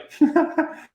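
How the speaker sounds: sudden high, bright laugh; real laugh. Real, not acted. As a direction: laugh freely with the audience. Laughs after reassuring the audience.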